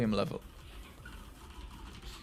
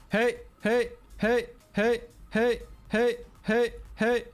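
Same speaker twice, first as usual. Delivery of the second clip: shouting; 7 semitones higher, slower than usual. Shown as a demonstration. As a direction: shout into the mic to test the peak. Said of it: "scream into your microphone".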